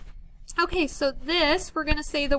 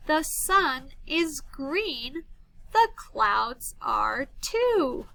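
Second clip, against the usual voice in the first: very slow, word-by-word read-aloud; drawn-out words with gaps between them; slow poem reading; much slower than usual. Shown as a demonstration. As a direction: read it at half speed for young children to follow. Teacher rereads poem for children.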